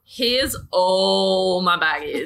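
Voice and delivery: drawn-out, emphatic "here it all is" declaration; upfront confession. Acted. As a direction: lay it all out, slow and loud. Acted frank disclosure to partner.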